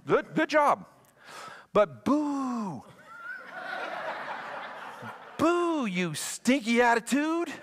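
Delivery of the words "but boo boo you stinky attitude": mock boo with a laugh; jokey heckle, slowed and laughing; playful booing. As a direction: boo them like a cheeky crowd. Preacher booing the leaders playfully.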